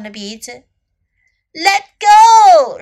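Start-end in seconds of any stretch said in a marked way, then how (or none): 1.5-2.8 high, loud storybook shout. A narrator's reading. Little elephant caught by crocodile, shouting to be released. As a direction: shout out in protest, pitch jumping up. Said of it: "shouted little elephant".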